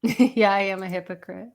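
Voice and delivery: ordinary talk, nothing marked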